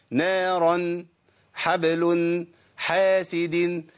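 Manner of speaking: "نارا، حبل، حاسد" slowly, each closing on an n; tanween sounded as noon. Shown as a demonstration. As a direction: let "نارا", "حبل" and "حاسد" end in a held n. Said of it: "ما أنا بقول نون أهو". (إظهار نون التنوين في "نارا، حبل، حاسد")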